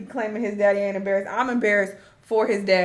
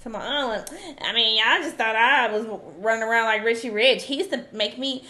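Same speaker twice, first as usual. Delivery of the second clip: normal talking voice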